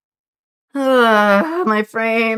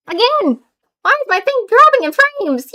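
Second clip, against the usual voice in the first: much higher, frustrated cry; exasperated outburst; 14 semitones higher than usual. Real, not acted. Streamer yelling at dropped frames, high exasperated outburst. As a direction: throw the question up in disbelief.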